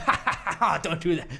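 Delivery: high mock chuckle into the words; put-on laughing protest; imitated laughing. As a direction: play someone laughing off a joke. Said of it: "laughing about it the whole time".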